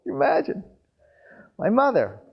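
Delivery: usual speaking voice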